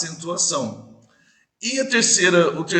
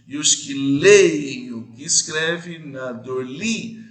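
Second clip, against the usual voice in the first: "E os que leem o que escreve" slow and louder, with the third syllable marked; stress on the third syllable; 3 dB louder, slower than usual. Shown as a demonstration. Teacher to class, scanning the verse slowly. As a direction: read out the verse slowly, leaning on the beat. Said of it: "Na terceira sílaba é mais forte".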